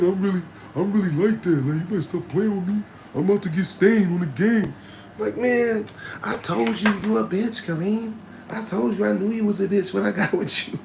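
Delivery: high, loud scolding; hammed-up furious girlfriend; angry girlfriend. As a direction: chew him out as his girl.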